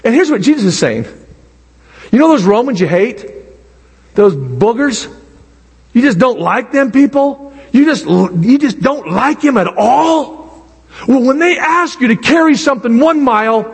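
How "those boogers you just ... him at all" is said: ordinary delivery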